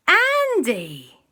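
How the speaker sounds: high, loud, falling exclamation; dramatic reveal. A narrator's reading. Storyteller revealing who woke them, dramatic exclamation. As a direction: hit the name big and high.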